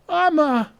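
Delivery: urgent cry of the name Atma; alarmed shout. Acted. Calling to fallen companion.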